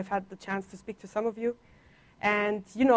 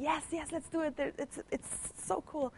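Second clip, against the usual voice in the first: high, softer excited cheer, slowing at the end; eager students; 7 semitones higher, 10 dB quieter than usual. Retold or imitated. Pupils eager to sign up for extra languages, high excited cheer. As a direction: gush like a keen pupil signing up.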